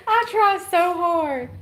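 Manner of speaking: high, slow, whimpering I try so hard; a hammed-up self-pitying wail; mock whine. Laughing at her own mistake with mock crying. Self-mocking after blunder.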